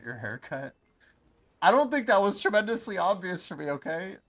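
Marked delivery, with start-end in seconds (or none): 1.6-4.2 high indignant voice. Pretend. Mock indignant squeaky voice.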